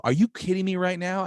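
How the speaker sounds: retold outrage. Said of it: "I lost it".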